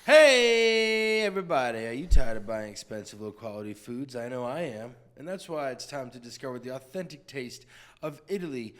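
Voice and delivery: upbeat commercial voice; ad read. Acted. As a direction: sell it like a TV ad. Fake ad read.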